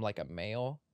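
male country voice. Shown as a demonstration. Host slipping into a guy's Southern accent, male country voice. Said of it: "context changed to male".

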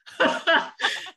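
high, breathy burst of laughter; airy high laugh. Real, not acted. Laughing at the sweet-talking joke, airy laugh.

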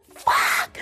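very high, squeaky curse; mortified squeal. Real, not acted. Podcaster reliving her cringiest moment, mortified squeal. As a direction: squeal the swear through a laugh.

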